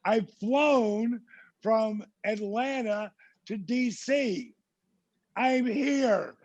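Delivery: high-pitched pleading reasoning; drawn-out, high acted rationalizing; self-justifying protest. Arguing with himself over telling.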